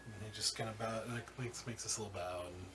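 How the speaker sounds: ordinary talk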